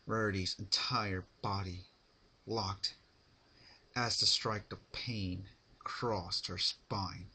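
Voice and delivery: ordinary delivery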